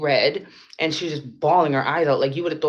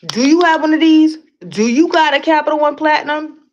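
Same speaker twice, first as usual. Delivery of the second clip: even louder, higher mock salesman; louder mock pitch; 12 semitones higher, 11 dB louder than usual. Teasing her viewers, she pushes the salesman bit louder. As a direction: push it harder, bragging about the card. Mocking a credit card pitch, repeated.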